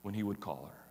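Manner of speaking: usual speaking voice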